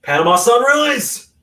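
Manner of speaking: loud, high excited call. Real, not acted. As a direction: call out the move like a fan. Calling out the move name.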